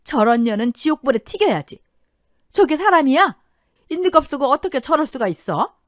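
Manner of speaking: high, loud indignant rant; scandalised, furious exclamation; boiling outrage. A narrator's reading. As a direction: rail at the cheaters on TV. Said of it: "머리 끝까지 화가 치밀었습니다".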